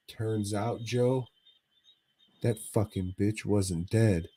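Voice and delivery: normal talking voice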